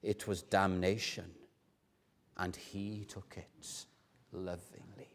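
low and soft, fading almost to nothing; hushed, overcome repetition. Acted. Professor in tears repeating it to his class, low and hushed. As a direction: repeat it tenderly, hinting at half sob, half laugh. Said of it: "a mix between a half sob and a half laugh".

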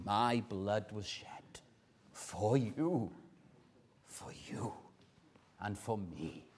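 low, soft, fading to a near whisper; hushed tenderness. Acted. Saviour reassuring son and daughter at the table, hushed and tender.

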